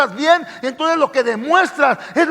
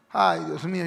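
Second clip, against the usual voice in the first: weary sigh on "Ay, Dios mío"; exasperated sigh; 8 semitones lower, 8 dB quieter than usual. Real, not acted. Pastor sighing over stubborn believers, exasperated. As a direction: sigh it out, fed up. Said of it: "yo digo".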